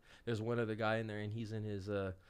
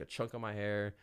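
normal talking voice

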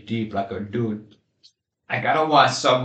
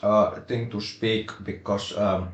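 rally English accent. Shown as a demonstration. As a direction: speak English like a Finnish rally driver. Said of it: "rally English is spoken like this".

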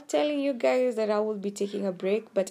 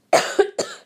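harsh unvoiced choking cough; 8 dB louder than usual. Real, not acted. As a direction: cough hard as if something went down wrong. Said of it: "I feel like I'm choking".

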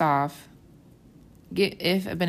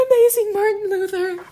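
much higher, louder fan squeal; starstruck gush; 8 dB louder than usual. Retold or imitated. Teen self gushing over Martin Luther, squealing.

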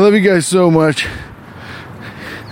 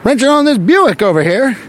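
high, hammed-up old-timey tough guy; 1920s factory worker; 5 semitones higher than usual. Comic imagining a 1920s factory worker, mock gangster voice. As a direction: ham up a "see"-saying 1920s worker. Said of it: "talked like that".

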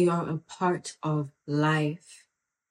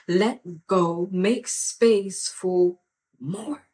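drawn out slowly; 4 dB louder, much slower than usual. Real, not acted. Slow emphatic sermon.

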